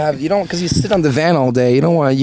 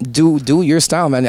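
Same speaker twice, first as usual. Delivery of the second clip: ordinary delivery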